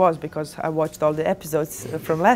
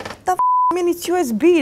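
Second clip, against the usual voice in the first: incredulous frustration; 10 semitones higher, faster than usual. Retold or imitated. Retelling broken controller frustration.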